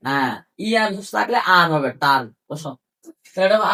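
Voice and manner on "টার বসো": ordinary talk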